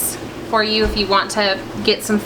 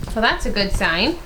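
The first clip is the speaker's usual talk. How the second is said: normal talking voice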